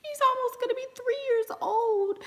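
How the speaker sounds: high, cracking tearful voice. Real, not acted. To viewers about her son turning three, tearful. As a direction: let the voice crack with tears.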